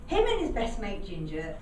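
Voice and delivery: plain reading voice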